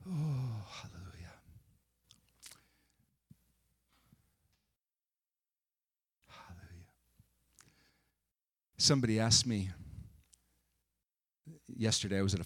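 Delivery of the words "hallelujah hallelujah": soft, fading whispered praise; whispered hallelujah. Real, not acted. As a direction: breathe the word out softly.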